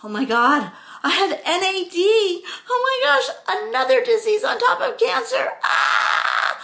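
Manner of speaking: high, loud panicked thoughts; freaking out at a scary word; panicked inner voice. Retold or imitated. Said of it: "And I'm thinking".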